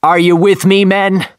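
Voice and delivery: loud, high rousing call; rallying call. A narrator's reading. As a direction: whip the crew into a frenzy. Ahab rallies the crew.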